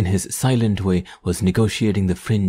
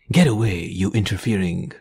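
usual speaking voice

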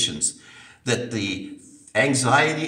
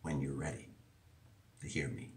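much softer, lower and intimate; gentle quiet voice; 7 semitones lower, 10 dB quieter, faster than usual. Retold or imitated. Preacher voicing God's gentle offer of help, much softer and lower. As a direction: drop to a near hush, tenderly. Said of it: "the one who says to us".

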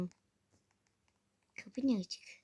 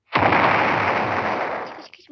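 yelling run; 12 dB louder, much slower than usual. Real, not acted. Fleeing in the game.

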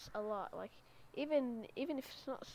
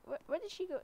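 normal talking voice

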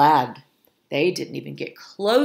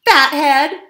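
high, loud scream; screamed insult; 9 semitones higher, 11 dB louder than usual. A narrator's reading. Hungry fox insulting the cook, high loud scream. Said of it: "screamed Flo".

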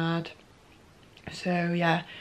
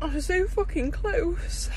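crying; 10 semitones higher than usual. Real, not acted. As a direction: pitch way up, sobbing the words out. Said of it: "I could have just burst into tears".

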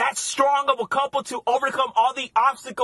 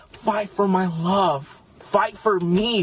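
slow, earnest pleading; slow dramatic plea; 3 semitones lower, much slower than usual. Acted. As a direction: drag every word out, slow.

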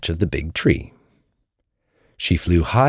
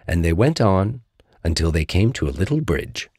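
ordinary delivery

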